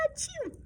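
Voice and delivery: high squeaky pretend sneeze; a sudden tiny achoo; cartoon kitten sneeze.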